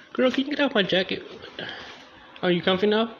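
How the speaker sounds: high, teasing; high playful talk to a dog. Real, not acted. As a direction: tease the dog on the jacket. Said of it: "talking to dog".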